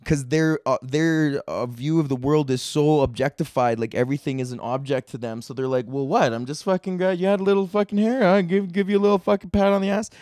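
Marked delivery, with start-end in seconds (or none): 5.9-10.1 mock creepy old man, higher; hammed-up leering old guy, pitched up. Creepy old guy voiced in rant.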